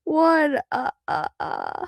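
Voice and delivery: put-on a child's take on the Count's counting laugh; mock vampire count; Count impression. Shown as a demonstration. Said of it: "Can you do that?".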